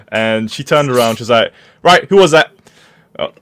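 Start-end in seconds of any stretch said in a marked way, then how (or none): 1.8-2.5 louder, higher retold demand; raised-voice mock telling-off; stern teacher. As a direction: lightly imitate a teacher confronting the class. Voicing teacher confronting the class.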